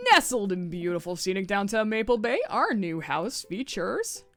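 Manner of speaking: fighting game announcer hype; cheesy announcer voice. Acted. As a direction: oversell the house like a TV announcer. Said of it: "do my best cheesy announcer voice".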